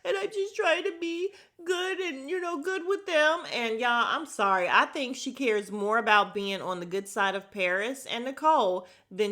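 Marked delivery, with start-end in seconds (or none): 0.0-3.4 high, breathy mock voice with a laugh; high sing-song mock of a castmate; mocking high Kyle voice. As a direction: parody her as prissy and high-pitched. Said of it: "And she's like".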